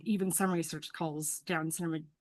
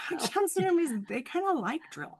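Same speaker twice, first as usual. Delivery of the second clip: high-pitched, laughing through the words; amused laughing; 10 semitones higher than usual. Real, not acted. Therapist amused that the kids like drill, laughing. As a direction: let real laughter lift the pitch.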